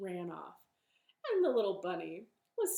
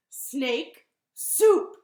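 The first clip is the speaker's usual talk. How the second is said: loud, punched announcement; bunny's reveal; 5 semitones higher, 16 dB louder than usual. Acted. Bunny names Gruffalo's favorite food to snake, loud and triumphant. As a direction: land each word separately and loudly. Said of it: "And Bunny said".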